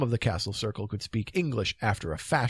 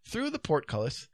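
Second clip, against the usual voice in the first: plain reading voice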